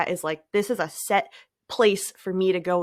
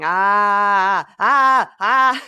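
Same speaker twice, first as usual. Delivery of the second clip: release shouts; 10 dB louder, much slower than usual. Shown as a demonstration. Showing how to vent anger, loud ah cries. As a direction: let out loud releasing yells. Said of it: "even maybe just saying".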